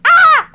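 high loud fan squeal; excited squeal. Retold or imitated. Fan spotting a favourite actor, high excited squeal. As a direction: let out a starstruck squeal.

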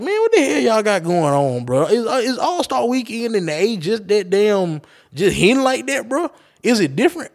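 high-pitched, baffled demand; incredulous questioning. Retold or imitated. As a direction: replay your disbelief, voice pitched well up. Acted bewildered reaction to the scam.

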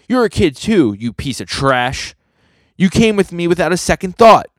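high, loud character voice in a quarrel; heated accusation. Acted. One character blames the other for coming along, indignant.

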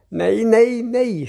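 high-pitched, urgent protest; cried out. A narrator's reading. Grandfather as the child moves the chair again, crying out. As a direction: cry no to head off disaster. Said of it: "skriker morfar".

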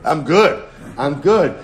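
mock loud self-congratulation; loud boasting. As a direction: proclaim it loudly, mimicking someone pleased with himself. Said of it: "speak about your accomplishments in a loud voice".

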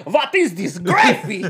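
slow, hammed-up Russian accent, half laughing; mock Russian accent with laughter breaking in; bad Russian accent. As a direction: do a clumsy Russian accent for a joke. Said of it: "Is that a Russian accent?".

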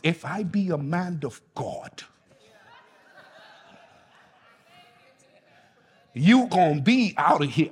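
a hint of a Bahamian preacher; Bahamian accent. Shown as a demonstration. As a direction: say it the way they do in the Bahamas. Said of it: "this is how they say it in the Bahamas".

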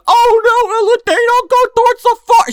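put-on very high, alarmed boy; hammed-up fear; mock panic. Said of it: "he's like".

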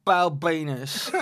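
slow, drawn-out put-on celebrity voice; Katie Price voice. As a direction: stretch the name out in character. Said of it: "as Katie Price".

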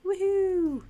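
cheering. Real, not acted. Cheering for the Jordans.

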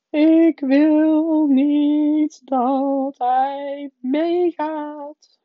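high, very drawn-out bratty wail; shrieking fake sobs. A narrator's reading. Spoilt son fakes crying to his mother, wailing. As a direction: wail each word long and high. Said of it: "krijste Dirk, tussen de gierende, geveinsde snikken".

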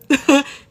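burst of laughter. Real, not acted. Laughs at own crying.

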